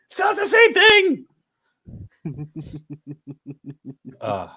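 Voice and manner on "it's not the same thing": high, squeaky mock protest; mock indignant. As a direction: play the outraged friend, high. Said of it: "incur the wrath of Jen".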